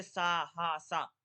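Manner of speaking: सा, हा said loud with push; with energy, as told; forceful demo. Shown as a demonstration. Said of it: "अगर इनको बोलोगे ना ज्यादा". (ताकत लगाकर उच्चारण)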